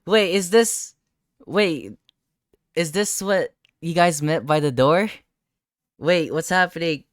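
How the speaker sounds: loud, high, frantic questions; scrambling to understand in real alarm; breathless shocked rambling; panicked disbelief. Real, not acted.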